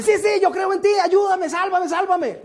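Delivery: high, loud frantic begging; desperate pleading. Retold or imitated. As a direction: beg for your life while dangling. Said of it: "el tipo llega y dice". (súplica desesperada, aguda y fuerte)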